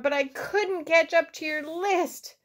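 high-pitched, breathless storybook apology; gasping. A narrator's reading. Frog apologising to Toad after running, gasping. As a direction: apologise as if winded. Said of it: "gasped Frog".

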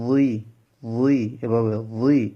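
উই drawn out slowly, thick and rounded; heavy rounded uy. Shown as a demonstration. Teacher slowly models a heavy উই for students. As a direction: stretch উই out slowly and heavily.

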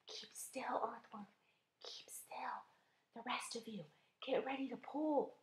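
very soft, tense whisper. A narrator's reading. James readying the earthworm and pullers, whispering. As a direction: whisper orders so the prey isn't startled. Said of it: "whispered James".

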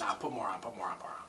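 hushed urging whisper. Real, not acted. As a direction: whisper it under your breath. Urging quietly off mic.

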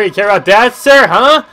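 very loud, high-pitched mock yell; mock yelling taunt. Streamer repeating his taunt at a game character, mock yelling. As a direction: scream the challenge at him.

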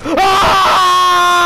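loud high cry of alarm; panicked yell. Real, not acted. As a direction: yelp as if hit. Getting shot in game.

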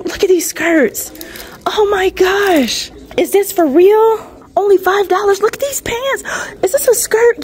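high, loud, drawn-out amazement; real excitement. Real, not acted. Vlogger showing viewers five-dollar skirts and pants, real excitement. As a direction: freak out over a bargain find. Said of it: "feel my excitement".

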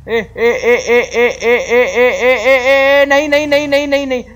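high, loud alarmed ए then pleading नहीं; real panic as the truck rolls back; panicked cries. Real, not acted. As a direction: cry out as the truck slides back.

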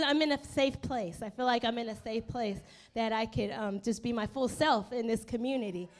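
usual speaking voice

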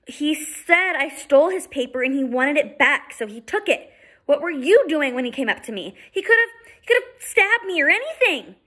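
put-on high, rising indignant girl; girl complains to Eddie, high and indignant; upset young girl.

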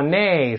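whinny it like a horse; horse neigh. Shown as a demonstration. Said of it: "那马吧".

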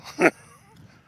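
high, loud burst of laughter. Real, not acted. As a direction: cackle out loud.

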